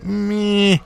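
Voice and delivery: high, dismissive "Meh"; unimpressed meh. Retold or imitated. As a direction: shrug it off with a bored meh. People shrugging off news.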